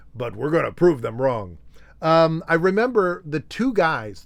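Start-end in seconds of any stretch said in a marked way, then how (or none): 0.1-1.6 mismatched dub actor's voice, not falsetto; mock overdub of a star's line; dubbed voice. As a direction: put on an ordinary-pitched stand-in voice. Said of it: "get somebody to dub his voice".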